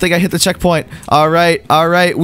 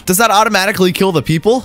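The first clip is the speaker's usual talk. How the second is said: ordinary talk, nothing marked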